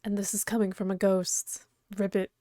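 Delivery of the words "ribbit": frog croak. Acted. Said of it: "I forgot she was a frog".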